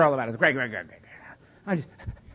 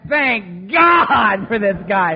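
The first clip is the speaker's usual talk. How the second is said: relieved; 3 semitones higher, 9 dB louder, much slower than usual. Retold or imitated. Relieved newcomer.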